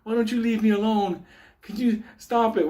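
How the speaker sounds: mild imitation of an exasperated woman; annoyed old lady. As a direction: mimic a fed-up lady begging to be left alone. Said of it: "And she's like".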